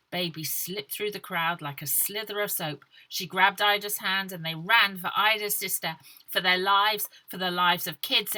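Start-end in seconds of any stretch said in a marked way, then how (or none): none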